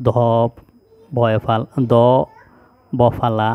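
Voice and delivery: ordinary talk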